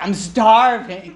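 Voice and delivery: drawn-out, loud mock complaint; whining hungry kid. Kid claiming hunger right after a meal, mock whining. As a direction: stretch it out like an overdramatic hungry child. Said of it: "you have the kids".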